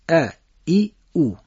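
ا، ای، او as separate short Arabic a, i, u; Arabic short vowels. Shown as a demonstration. Teacher demonstrating Arabic vowels to listeners, distinct and separate. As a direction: say ا، ای، او apart, Arabic style. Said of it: "تلفظ عربی".

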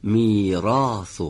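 میراث very slow, the ر said full and heavy with open a; heavy r demo. Shown as a demonstration. Teacher correcting student, slow heavy r. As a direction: draw out میراث, thick ر.

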